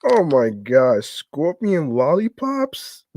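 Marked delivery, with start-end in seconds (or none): none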